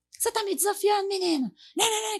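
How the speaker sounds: high-pitched, confrontational mock scolding; mock angry yell. Mother challenging her hot-tempered daughter, mock angry yell. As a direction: act out a parent blowing up, pitch jumping way up. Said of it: "Começar aquela grita".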